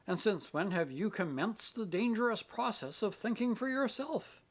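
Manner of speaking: sarcastic. Acted. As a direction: sneer the question sarcastically. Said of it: "I cannot bear satire from you".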